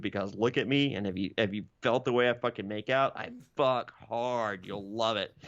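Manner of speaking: a hint of a low, earnest suitor; lightly voiced low suitor. Podcaster voices Luke P to Hannah, low mock-earnest.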